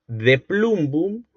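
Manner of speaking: De plumbum said very slowly, each syllable drawn out; slow enunciation. Shown as a demonstration. Teacher showing students the Latin word, slowly enunciated. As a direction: spell out De plumbum slowly for the class. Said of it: "Fíjense ustedes".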